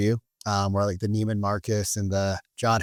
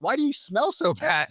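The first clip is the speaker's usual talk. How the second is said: high mock voice of the friend, a laugh inside; teasing high-pitched question; lightly amused high impression; French friend voice; 9 semitones higher than usual. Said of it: "And he's like".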